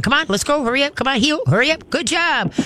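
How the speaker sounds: high-pitched cheerleading dog talk; urging a dog along. Shown as a demonstration.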